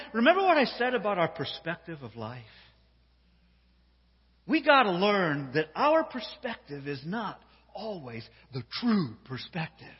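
ordinary delivery

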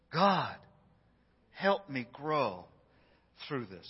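slow, hushed, falling prayer; quiet prayer. Pretend. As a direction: pray softly and slowly. Modelling a prayer.

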